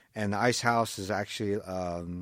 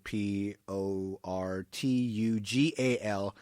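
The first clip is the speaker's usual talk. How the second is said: spelled out slowly; 6 dB louder, much slower than usual. Shown as a demonstration. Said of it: "there's a period in their name".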